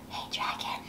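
unvoiced whispered "Hey, dragon". Shown as a demonstration. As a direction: breathe it without voice. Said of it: "Why did she go whisper".

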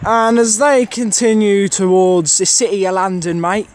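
a quick, badly done Cockney impression; bad mock Cockney accent slipping back toward his own voice; terrible Cockney accent. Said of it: "that was a terrible Cockney accent".

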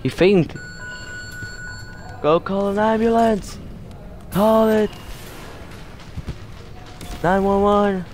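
hammed-up emergency call in a high voice; mock alarm. Mock emergency over fainted opponent.

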